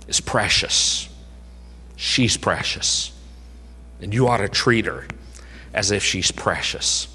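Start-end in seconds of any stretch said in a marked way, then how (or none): 0.1-3.1 hushed tender whisper. Acted. Tender point about a precious wife.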